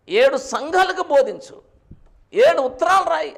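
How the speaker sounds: loud, high divine command; trumpet-like voice of God. Retold or imitated. God orders John to teach seven churches, loud trumpet-like command. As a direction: issue orders like a ringing trumpet. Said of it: "బూరధ్వని వలె".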